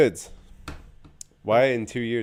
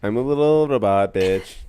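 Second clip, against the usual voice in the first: slow, louder robot declaration; 7 dB louder than usual. Pretend. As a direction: announce it like a dumb robot. Playing the robot assistant.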